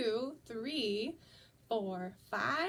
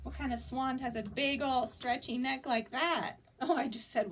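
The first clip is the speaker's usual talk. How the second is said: ordinary delivery, nothing marked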